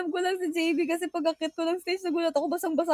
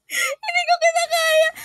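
laughing cry; 12 semitones higher, 6 dB louder, slower than usual. Real, not acted. Overwhelmed, laughing crying.

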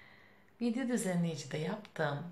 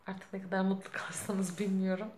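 normal talking voice

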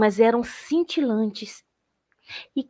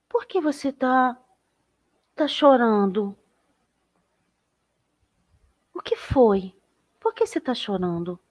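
softer, tender questioning; gentle concern; 4 semitones higher, 3 dB quieter than usual. Acted. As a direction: coax a sad little one to talk. Traveler asks why it cries.

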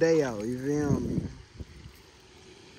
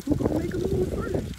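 squeaky mock suspense; 4 dB louder than usual. Real, not acted. Dog and snake standoff.

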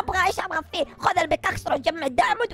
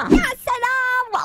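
drawn-out delight; 9 semitones higher, 5 dB louder, much slower than usual. Acted. Delighted at the fancy car.

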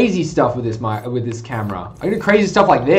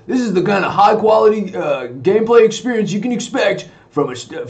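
ordinary talk, nothing marked

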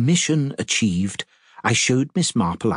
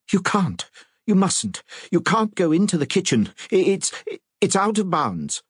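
flustered; 10 semitones higher than usual. A narrator's reading. Vicar flustered, blocking kitchen.

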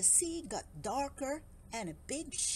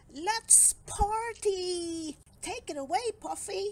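excited cry; 7 semitones higher, 5 dB louder than usual. A narrator's reading. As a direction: kick off the party with gusto. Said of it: "he cried".

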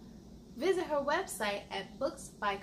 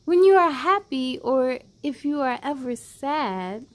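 louder, expressive picture book reading; reading to children; 10 dB louder than usual. Acted. Host reading a loving picture book to kids, expressive and louder. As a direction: read it out like a bedtime story for little ones.

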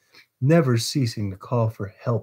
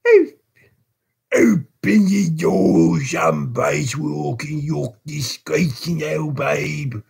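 loud, raised, fierce ruffian's yell; fierce cry; 5 semitones higher, 3 dB louder, slower than usual. A narrator's reading. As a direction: bellow it fiercely, drawing the words out. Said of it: "cried Sykes fiercely".

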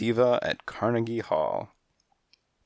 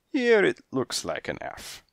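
indifferent; 12 semitones higher than usual. A narrator's reading. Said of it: "shrugging indifference".

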